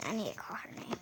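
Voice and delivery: hushed unvoiced whisper. Real, not acted. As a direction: drop to a whisper. Whispering aside about parents.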